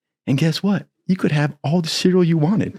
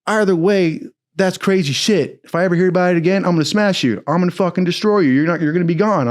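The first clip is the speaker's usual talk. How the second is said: raised, higher threat to smash him; threatening chief; 5 semitones higher than usual. Retold or imitated. Chief threatening to destroy the recruit, raised and angry. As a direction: warn him hard, higher voice. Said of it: "He goes".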